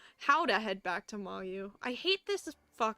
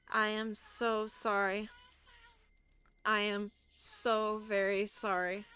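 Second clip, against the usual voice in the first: slow monotone; slower than usual. Acted. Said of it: "slowly repeats".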